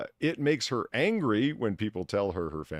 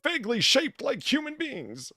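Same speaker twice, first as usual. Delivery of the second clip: high mocking voice, rising higher; mock high-pitched quote; 13 semitones higher, 6 dB louder than usual. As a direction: read it like a prissy influencer.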